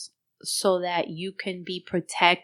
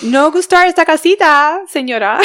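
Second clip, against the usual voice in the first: mock broken Spanish plea; 8 semitones higher, 10 dB louder than usual. Mock pleading to the lady.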